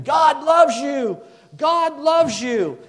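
high, loud, drawn-out shout; screaming it out. Retold or imitated. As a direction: imitate a man yelling slowly over a highway. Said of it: "he's just screaming".

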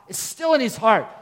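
usual speaking voice

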